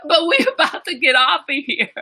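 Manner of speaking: high-pitched, real laughing speech; laughing while talking. Real, not acted. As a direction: talk through a fit of laughter. Cracking up while signing off.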